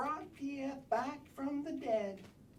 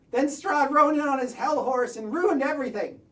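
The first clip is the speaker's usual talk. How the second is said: ordinary talk, nothing marked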